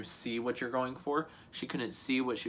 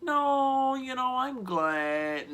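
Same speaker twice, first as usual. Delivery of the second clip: high, slow, squeaky put-on voice; mock reaction; 14 semitones higher, 3 dB louder, much slower than usual. Answering someone vicious, mock sweet. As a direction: ham up a fake pleasant reply. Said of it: "I'm like".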